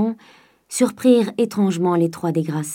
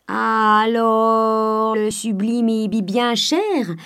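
put-on the old miser stumbles, pitch rising; sly old man faking a stammer; 3 semitones higher, much slower than usual. A narrator's reading. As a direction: break on the word, playing the simpleton. Grandet stammers feigning naivety.

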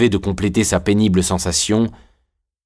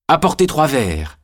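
higher, lightly eager request; mildly excited, pitched up; childlike eagerness; 6 semitones higher than usual. A narrator's reading. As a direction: ask with a touch of a child's haste. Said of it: "avec un empressement d'enfant".

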